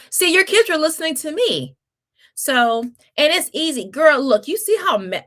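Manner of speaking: high, loud and emphatic; excited gush. Real, not acted. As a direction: cheer the viewer on. Excited over kids' comment.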